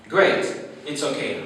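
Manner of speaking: usual speaking voice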